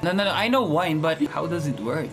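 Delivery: ordinary delivery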